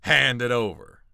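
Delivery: loud mock bandit threat; hammed-up highwayman demand. Bandits ambushing the party on the road, loud mock threat. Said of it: "they're just like".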